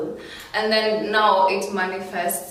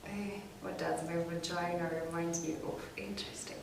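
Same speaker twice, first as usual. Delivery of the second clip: very soft, thinking aloud; quiet musing; 14 dB quieter than usual. Real, not acted. Guest ponders the host's question about herself, quiet musing. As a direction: murmur the question back to yourself.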